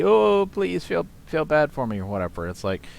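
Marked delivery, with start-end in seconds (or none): 0.0-2.0 sarcastic poor-me voice; mock self-pity. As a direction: parody a pitiful plea for sympathy. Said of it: "that sounds like it's a".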